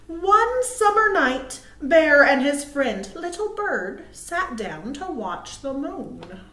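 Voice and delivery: slow, expressive read-aloud; reading to children. Acted. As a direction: read it like a bedtime picture book. Reading picture book aloud.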